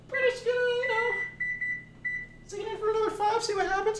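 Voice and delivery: high sing-song voice. Acted. Putting pie back, sing-song.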